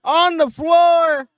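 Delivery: shouting the big spot, high and slow; excited shout. Real, not acted. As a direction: yell it like a live call. Live reaction to a spear.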